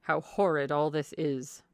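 slow, sour grumble; disgusted husband's complaint; complaining. A narrator's reading. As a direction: grumble it slowly and heavily. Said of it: "began complaining of the weather".

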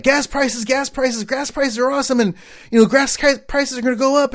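high-pitched mocking rant about gas prices; father-in-law ranting. Son-in-law mimicking his father-in-law's gas price rant, high and pushed. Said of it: "my father-in-law is like".

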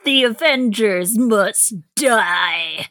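slow, drawn-out villain threat; menacing robot villain proclamation; Arsenal villain voice. Acted. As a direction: draw out every word as a doom-laden villain. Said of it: "what is Arsenal saying".

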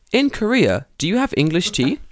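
high, loud faux-innocent question; mock innocent. Teacher needles Tanaka sensei with a fake-innocent question. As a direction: ask it sweetly to needle him. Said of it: "Tanaka Sensei".

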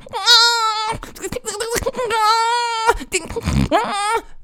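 very high mock baby wail; hammed-up infant bawling; imitated baby crying. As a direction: wail like a squalling baby, long and shrieky. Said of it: "the baby going".

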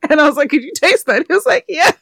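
high, loud, giggly retelling; cracking up. Real, not acted. Host recounting the burped-in-mouth exchange, cracking up. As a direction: tell it through laughter, pitch climbing right up.

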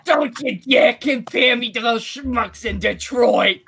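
drawn-out, indignant New Jersey accent, hammed up; mock-indignant Jersey accent. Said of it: "Yeah, she's like".

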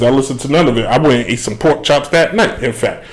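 normal talking voice

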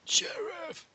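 high, far-off call for the sheriff. Pretend. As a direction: yell it out high like a movie victim. Calling out for the sheriff.

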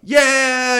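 high, loud cheer; crowd cheer. Retold or imitated.

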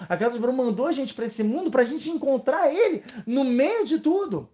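rising into a high, urgent appeal; heartfelt acted entreaty, increasingly high; impassioned plea. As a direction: plead to be found, building in pitch. Voices God pleading to be found.

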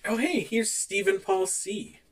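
ordinary talk, nothing marked

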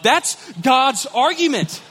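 ordinary delivery

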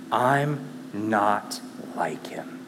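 a soft unvoiced whisper, slow; whispered confession. Believer admitting to himself he is unlike Jesus, whispered. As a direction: admit it under your breath.

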